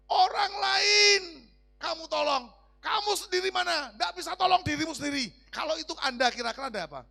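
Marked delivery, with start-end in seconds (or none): 0.1-5.3 loud, high taunting shout; jeering shout. Acted. Passers-by taunting the man on the cross, jeering shout. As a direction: jeer loudly, dragging out the first words.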